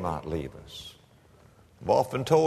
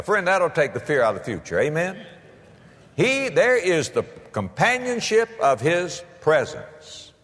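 normal talking voice

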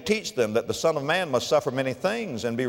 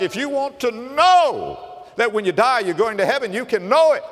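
raised, pressing pulpit voice; preaching at full force; 8 semitones higher, 3 dB louder than usual. Acted. Preacher urging the congregation to be saved, raised full-force preaching. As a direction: drive it home to the congregation. Preacher pleading about salvation.

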